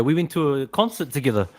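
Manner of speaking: normal talking voice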